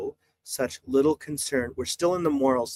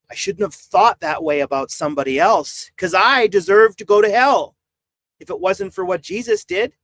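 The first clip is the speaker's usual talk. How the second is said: building to loud, higher self-reproach; impassioned acted confession; 5 semitones higher, 7 dB louder than usual. Livestreamer voicing a sinner confessing unworthiness before God, fervent and loud. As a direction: act out repentance, rising in pitch and force.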